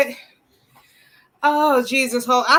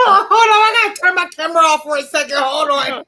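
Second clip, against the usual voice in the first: higher, laugh-filled words; real laughing fit; 4 semitones higher, 3 dB louder than usual. Real, not acted. Woman excusing herself from camera, laughing too hard. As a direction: struggle to talk while cracking up. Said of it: "That's why I got my camera off".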